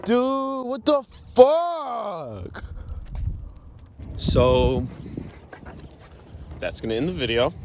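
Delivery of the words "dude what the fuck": high, drawn-out shout of frustration; livid outburst. Real, not acted. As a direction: shout it slowly in real exasperation. Livid about the broken board.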